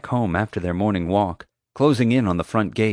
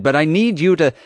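high, loud desperate plea cut off; pleading; 8 semitones higher, 6 dB louder than usual. Acted. Boy begging the guard before she gags him, loud plea. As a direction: beg urgently until silenced. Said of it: "I pleaded".

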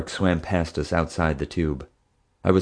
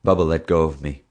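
usual speaking voice

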